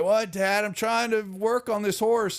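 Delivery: put-on high-pitched, protesting young man's voice; high, complaining reply to Dad; put-upon son. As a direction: answer Dad as an interrupted son. Son answers father's call in roleplay.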